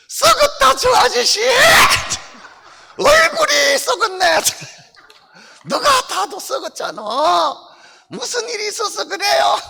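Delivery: high, bold little puppet boasting. Acted. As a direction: brag proudly as the puppet doll. Puppet mocks a man's face. (당당하게 자랑하는 높은 인형 목소리)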